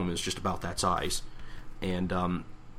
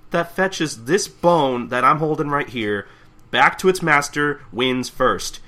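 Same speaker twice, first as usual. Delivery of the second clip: lightly voiced announcer, higher and slower; announcer explaining rules; 6 semitones higher, 6 dB louder, slower than usual. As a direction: spell out the rules to contestants. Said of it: "he just explains that".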